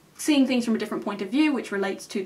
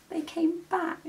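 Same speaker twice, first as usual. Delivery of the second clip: hushed thrilled reveal; excited whisper; 8 semitones higher, 5 dB quieter, slower than usual. Real, not acted. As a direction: whisper it like a secret.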